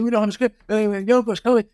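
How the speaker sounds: high-pitched anxious fretting; worked-up retold worry, pitched high. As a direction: replay a panicky moment, voice jumping up high. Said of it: "I was really anxious".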